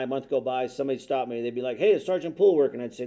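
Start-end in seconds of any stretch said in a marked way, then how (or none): 1.8-2.8 imitated street hail, higher and louder; quick, raised-voice greeting to an officer; calling out. Passerby stops a policeman to ask, calling out.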